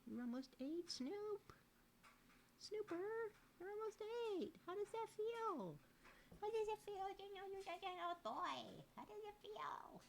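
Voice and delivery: very high, soft squeaky coo. Real, not acted. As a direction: coo at the aging cat with fond mock pity. Baby talk to aging cat.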